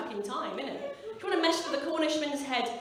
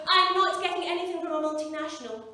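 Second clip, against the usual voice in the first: higher, louder mock resolve; principled declaration; 4 semitones higher, 5 dB louder than usual. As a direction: proclaim it like a vow. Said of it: "you start off going".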